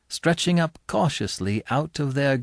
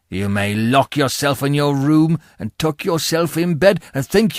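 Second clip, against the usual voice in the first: menacing convict voice, rising to loud threats; fearful threats; 3 semitones lower, 3 dB louder than usual. Acted. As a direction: scare the boy into obedience. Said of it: "went on in these fearful terms".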